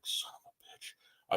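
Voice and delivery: slow whispered curse under the breath; whispered, drawn-out swear; muttered curse. Retold or imitated. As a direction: breathe it out, beaten. Missed the contest.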